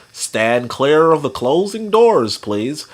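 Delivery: slow, measured train announcement. Acted. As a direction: enunciate it slowly like a conductor.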